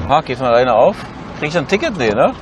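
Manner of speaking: normal talking voice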